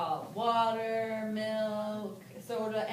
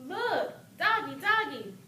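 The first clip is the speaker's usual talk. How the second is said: put-on high, slowish toddler call; child voice; 9 semitones higher, 4 dB louder, slower than usual. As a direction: point it out like a little kid. Child role, second script.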